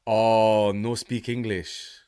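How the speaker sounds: drawn-out mock Indian man; slow, retold put-on voice of an Indian stranger; imitated Indian man. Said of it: "I believe he was Indian".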